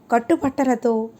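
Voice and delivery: ordinary delivery, nothing marked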